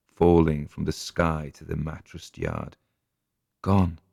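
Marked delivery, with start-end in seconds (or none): none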